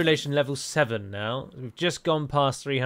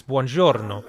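buongiorno with Italian flair and rolled r; Italian accent; 5 dB louder, slower than usual. Shown as a demonstration. Non-native showing his closest buongiorno, Italian accent with flair. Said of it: "which is far more Italian sounding".

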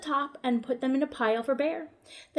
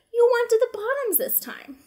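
innocent hare; 11 semitones higher, 5 dB louder than usual. Acted.

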